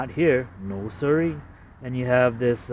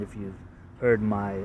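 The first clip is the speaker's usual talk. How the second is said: usual speaking voice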